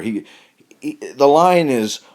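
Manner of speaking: ordinary talk, nothing marked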